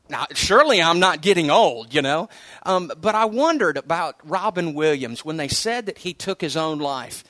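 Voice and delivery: ordinary talk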